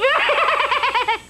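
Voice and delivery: high cackling witch laugh; witch cackle. Acted. Little old witch cackles after casting her spell, high. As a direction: let out a pleased little cackle.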